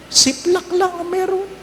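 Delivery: high mock jeer. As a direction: scoff at them in a high put-on voice. Mocking those bringing only toothpick.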